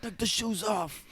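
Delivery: put-on New York tough guy; New York mobster. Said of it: "Hey, Ma".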